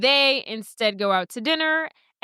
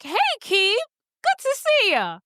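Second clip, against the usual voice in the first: high, singsong casual hello; put-on light, high acquaintance greeting; 10 semitones higher than usual. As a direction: play it breezy and casual, pitched well up. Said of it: "very casual".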